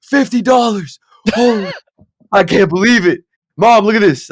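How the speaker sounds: high, loud re-enacted shout of joy; freaking out. Player re-enacts showing mom his first winnings, freaking out. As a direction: yell it like an overjoyed kid with winnings. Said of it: "And I was freaking out".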